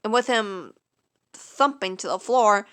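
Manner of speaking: ordinary delivery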